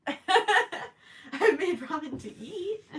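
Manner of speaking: slow words through laughter; laughing. Real, not acted. Answering a viewer about ramen, laughing. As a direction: giggle the answer out.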